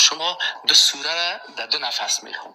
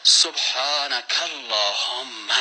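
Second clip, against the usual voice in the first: slow recitation; 2 semitones lower, 3 dB quieter, much slower than usual. Shown as a demonstration. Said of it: "نماز طریقه اساساً".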